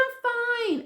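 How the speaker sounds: very high-pitched imitation of mom; put-on high, unbothered mother; mother's voice. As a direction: brush off the worry in a high voice. Voices mother reassuring her.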